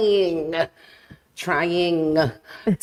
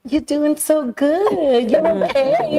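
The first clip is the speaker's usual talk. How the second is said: high, slow coaxing voice, much higher pitch; soothing grandma; 8 semitones higher, 3 dB louder, much slower than usual. Retold or imitated. Grandma soothing her granddaughter during hair combing, high and gentle. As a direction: gently coax a fussy granddaughter.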